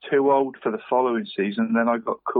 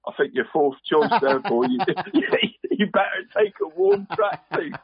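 dad's words half-laughed out, rising much higher; can barely get the quote out for laughing; real giggles breaking into the old man's line; quoting dad through laughter; 7 semitones higher than usual. Retold or imitated. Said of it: "and, and said".

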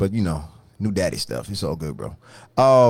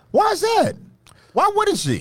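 mock disbelief, much higher; feigned innocent questions, pitched way up; sarcastic; 3 dB louder than usual. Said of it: "I'm being dead serious, sarcastically".

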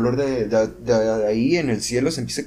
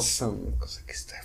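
whispered; 3 semitones lower, 9 dB quieter than usual. Real, not acted. Whispered warning about ayahuasca.

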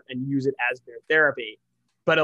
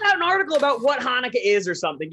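exasperated rant; 9 semitones higher, 5 dB louder, faster than usual. Real, not acted. Ranting at the newspaper.

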